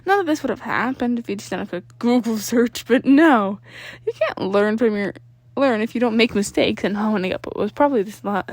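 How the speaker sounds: ordinary delivery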